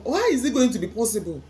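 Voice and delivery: usual speaking voice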